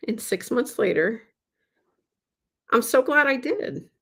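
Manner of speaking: ordinary delivery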